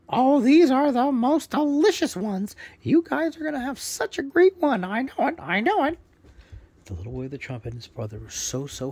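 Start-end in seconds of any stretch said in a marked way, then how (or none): 0.1-6.0 high, loud squawky parrot; proud parrot introducing himself, high and loud. Acted. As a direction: chirp it out as a cheerful parrot bird. Parrot finds the grubs.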